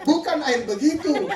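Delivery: imitated irritated complaint; angry. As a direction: object like a cross customer. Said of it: "marah-marah lagi tuh temanku".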